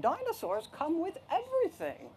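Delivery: high, lilting nurse voice; chirpy nurse. Acted. Nurse explaining the dinosaurs to mom, chirpy singsong. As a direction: swoop up high as if it's obvious. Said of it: "the nurse explained".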